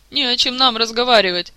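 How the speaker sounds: loud, angry outburst; angry shout. A narrator's reading. Orlando turning on the king, shouting angrily. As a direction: snap it out in sudden fury. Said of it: "с неожиданной злостью в голосе выкрикнул тот".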